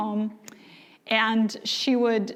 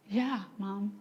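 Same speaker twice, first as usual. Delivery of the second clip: quiet grudging reply; 9 dB quieter than usual. Retold or imitated. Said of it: "daughter's grudging answer".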